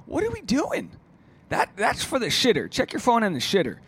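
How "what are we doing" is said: mock baffled. Mock exasperated friend.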